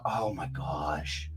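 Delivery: slow, low, soft oh my gosh; dad melting at baby's tears, low and soft; heartbroken sigh. Retold or imitated. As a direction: let it sink out of you. Dad's heartbroken reaction.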